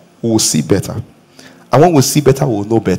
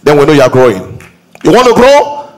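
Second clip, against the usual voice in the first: raised, higher preacher's voice driving the point home; fired-up preacher urging the congregation; preaching at full force; 6 semitones higher than usual. Acted. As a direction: pitch it up and push, like a pulpit climax.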